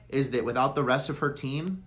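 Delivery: ordinary delivery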